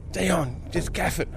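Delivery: high, tense, hushed-down urging; urgent retold plea. Angler urging his mate to gaff it, high urgency. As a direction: press your mate in a high voice.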